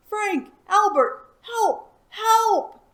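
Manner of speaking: high, loud calls for help; yelling. Acted. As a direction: scream the names across the cave. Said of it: "yelled Elmer again".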